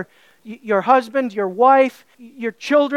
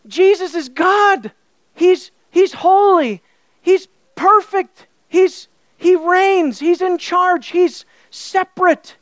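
higher, louder, slow declarations of holiness; awed proclamation; 5 semitones higher, 3 dB louder, slower than usual. Retold or imitated. Preacher voicing how believers feel about Jesus, awed and loud. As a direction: proclaim each attribute with awe.

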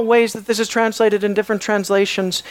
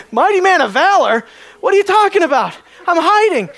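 higher and louder, scoffing disbelief ending in a laugh; mock-incredulous what are you talking about; 9 semitones higher, 3 dB louder than usual. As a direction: scoff at the title, amused at yourself. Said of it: "which part should he really be challenging".

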